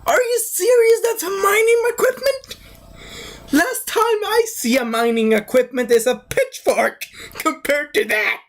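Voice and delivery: very high squeaky disbelief; hammed-up baffled indignation; incredulous outburst. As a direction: start in falsetto, then stay high and protesting. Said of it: "and I'm like".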